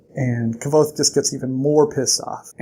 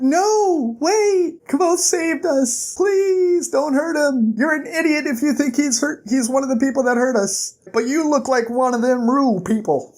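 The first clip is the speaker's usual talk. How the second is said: much higher voice for Kryn, pleading; pleading girl voice; 10 semitones higher than usual. Retold or imitated. Kryn begging the townsmen not to hurt Kavoth, high pleading voice. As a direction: plead high and urgent to protect him. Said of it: "Kryn's like".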